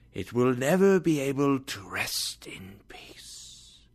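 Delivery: a ghost breathing out his warning; hushed, hollow spirit voice; ghostly whisper. Acted.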